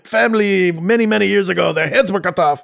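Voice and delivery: high, hammed-up French accent; over-the-top French accent. Pretend royal boasting of a family chateau, mock French accent. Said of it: "We keep talking to them in this".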